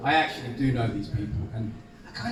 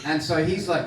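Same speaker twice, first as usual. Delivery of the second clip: normal talking voice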